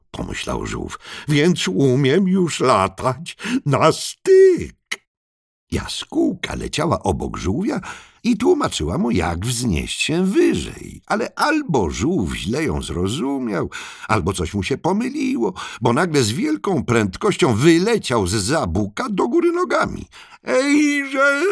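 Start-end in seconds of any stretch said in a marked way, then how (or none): 1.3-4.8 high, slow, louder character voice; marvelling. Acted. Turtle thinking of its new flying skill, high and drawn out. 20.4-21.4 very high-pitched holler; calling out. Acted. Turtle shooting up feet first, hollering high. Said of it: "wołał".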